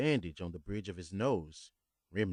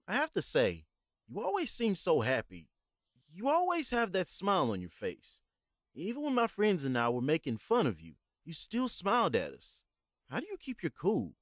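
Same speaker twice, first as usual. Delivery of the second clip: higher, earnest rushed apology; nervous young man apologizing; 10 semitones higher, 3 dB louder than usual. Acted. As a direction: blurt out the confession to the old man. Young narrator asks the old man.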